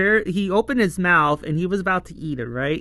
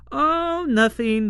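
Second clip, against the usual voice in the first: high, swooping mock-innocent brush-off; exaggeratedly nonchalant cover-up; feigned innocence; 9 semitones higher, 3 dB louder than usual. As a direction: sing out a hammed-up casual denial. Twins acted, hiding their scheme.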